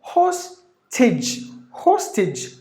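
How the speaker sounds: teacher's careful model of "hostage"; stress on first syllable. Shown as a demonstration. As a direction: punch HOS in "hostage", then a light -tij. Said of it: "give the volume for the first syllable".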